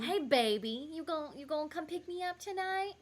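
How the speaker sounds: high, loud mock flirting; cringey come-on. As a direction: ham up a cheesy pick-up line. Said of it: "send him the cringiest messages".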